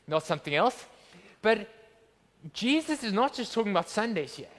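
usual speaking voice

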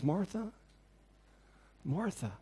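soft, hushed calling of her name; gentle rebuke. Acted. Jesus calling Martha softly and gently. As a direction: call her name tenderly.

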